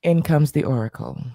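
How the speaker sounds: low, solemn oracle announcement. Pretend. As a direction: intone it like a prophet arriving. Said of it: "damn, it's that voice".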